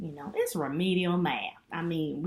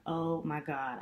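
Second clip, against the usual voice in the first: usual speaking voice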